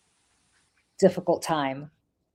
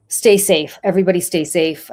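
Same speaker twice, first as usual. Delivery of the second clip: earnest plea; 8 dB louder than usual. Real, not acted. Urgent sign-off to listeners.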